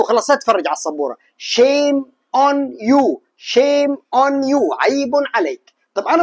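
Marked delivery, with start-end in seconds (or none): 1.4-4.8 very slow, every word drawn out; slow drill. Shown as a demonstration. Teacher drilling a phrase for students, very slow. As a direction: spell out each word slowly for the learner. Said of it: "احفظها بالتكرار".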